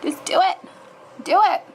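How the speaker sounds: will the site to let her in; urgent pleading. Real, not acted. Vlogger begging the website to let her in, excited. Urging signup to go through.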